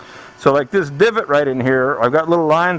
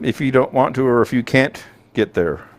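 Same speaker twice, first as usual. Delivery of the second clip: usual speaking voice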